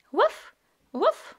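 high little puppy woof; puppy bark. Acted. Voicing the puppy Biscuit barking.

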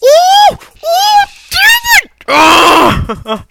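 very high, loud cries; exasperated shouting. Real, not acted. Gamer mad at own failure, exasperated shouting. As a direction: yell as the frustration boils over. Fails, getting mad at himself.

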